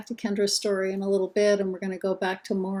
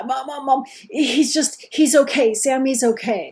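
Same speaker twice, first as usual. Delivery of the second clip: higher, louder, stammering panic; imitating panicked son; 5 semitones higher, 7 dB louder than usual. Older son on phone to mom, panicked and stammering. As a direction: voice the son, stuttering and near tears. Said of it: "part stuttering, part crying".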